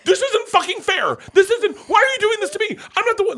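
very high, loud, anguished protest; retold tearful outburst. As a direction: re-enact falling apart mid-argument. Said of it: "I fall apart crying".

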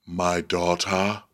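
retold angry king. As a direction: intone it slowly as a wounded king. Voices the king confronting Daemon.

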